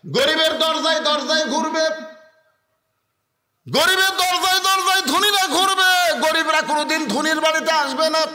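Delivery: high, loud sermon cry held on one note; full-force preaching. Acted. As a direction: declaim it high over the crowd.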